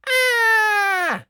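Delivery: very high loud shriek; witch shriek. Acted. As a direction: shriek in outrage. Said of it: "shrieked the witch".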